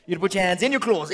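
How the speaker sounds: usual speaking voice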